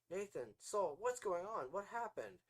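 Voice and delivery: high, loud frantic calls; panicked mother. A narrator's reading. Mother panics at her son's body, high and loud. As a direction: cry out in rising panic.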